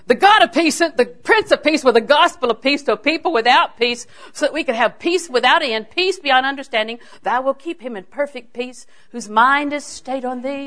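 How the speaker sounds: loud, driving sermon delivery; emphatic preaching. Real, not acted. Preacher proclaims God's peace to congregation, emphatic preaching.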